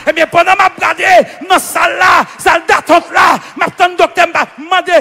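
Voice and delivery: forceful pulpit storytelling; full-force preaching. Real, not acted. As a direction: preach it out in insistent bursts.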